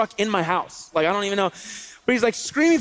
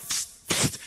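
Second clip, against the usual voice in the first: two quick soft hisses of air; voiceless "psst" sound effect; imitated web shots; 8 dB quieter than usual. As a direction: make a web-shooter noise. Imitates shooting webs at the kids.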